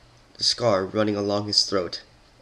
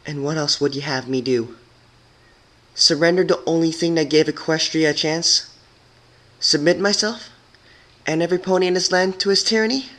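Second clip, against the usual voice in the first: controlled defense; 8 semitones higher, 4 dB louder than usual. Acted. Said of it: "but she remained calm".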